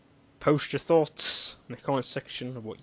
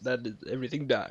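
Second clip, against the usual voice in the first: normal talking voice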